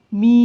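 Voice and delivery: মি held long, "miii", breath unblocked; drawn-out open vowel. Shown as a demonstration. Teacher showing an open syllable, মি drawn out. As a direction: stretch the vowel of মি and leave it open. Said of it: "মুক্ত আছে".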